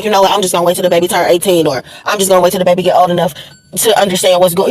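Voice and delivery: lightly voiced resigned man, low and quick; low, fast imitation of a fed-up father; resigned men. Voices men planning to wait.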